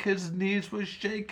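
plain reading voice

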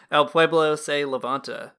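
Spanish title in an American accent. Real, not acted. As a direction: read the Spanish with an English-speaker accent. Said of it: "forgive my Spanish pronunciation".

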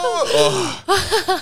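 very high, drawn-out mock squeal; imitated burst of excited shrieking; confetti cannon squeal. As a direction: explode into a high sustained "ooh". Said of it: "were like a fucking confetti cannon".

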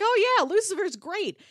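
ordinary talk, nothing marked

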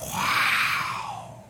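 long, breathed-out wow; very soft, stunned exclamation; hushed amazement. Acted. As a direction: whisper a stretched, awestruck wow. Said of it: "they went".

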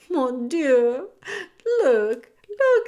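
loud, high excited calling; excited French mother. Acted. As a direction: cry out in delight. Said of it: "says Antoinette".

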